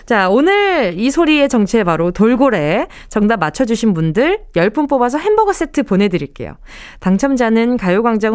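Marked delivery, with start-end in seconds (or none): none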